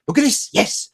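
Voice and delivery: booming street peddler pitch. Retold or imitated. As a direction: sell it like a carnival merchant. Peddler hawking wares.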